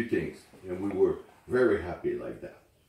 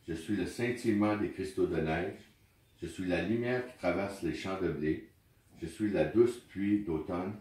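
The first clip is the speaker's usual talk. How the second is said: poem recitation; 2 semitones lower than usual. Acted. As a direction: read it gently, like at a memorial. Reads the poem in French.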